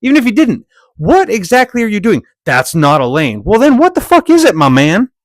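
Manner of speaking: high, loud, incredulous swearing; outraged shout. Acted. Yelling at the driver who says it's not a lane, outraged. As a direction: blow up at him, up high.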